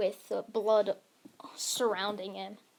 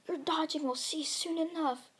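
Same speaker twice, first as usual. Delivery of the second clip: higher-pitched, confident threat; acted villain's warning, pitched up; villain's taunt; 6 semitones higher than usual. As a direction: threaten the opponent without shouting. Villain threatens tiring hero.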